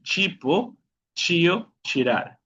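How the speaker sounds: "Chipo, chio, chirar" opening on ch. Shown as a demonstration. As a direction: list "Chipo, chio, chirar" stepping down to the last. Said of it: "Cuando la T y la I están juntas". ("Chipo, chio, chirar" con sonido de ch)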